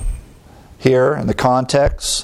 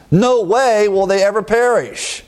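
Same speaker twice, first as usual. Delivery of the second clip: "No way" pitched high and held up; emphatic; 8 semitones higher than usual. Shown as a demonstration. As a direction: hammer "No way" in a raised, insistent pitch. Emphatic paraphrase of the double negative.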